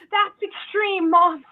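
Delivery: put-on protesting child. Child tells mom it's extreme, high-pitched protest. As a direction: talk mom down as a shocked kid.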